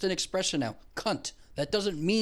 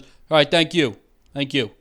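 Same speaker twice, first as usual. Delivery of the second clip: curt thanks; 7 dB louder than usual. Retold or imitated. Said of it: "the guy goes".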